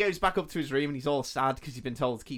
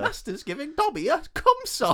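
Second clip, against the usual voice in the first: high, loud house-elf squeak; Dobby voice; 6 dB louder than usual. Retold or imitated.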